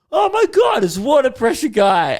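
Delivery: loud, high, excited outburst; excited shout. Retold or imitated. Drunkenly yelling as he walks in.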